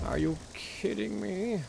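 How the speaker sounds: exasperated disbelief. Real, not acted. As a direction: stretch it out in disbelief. Disbelief at motor count.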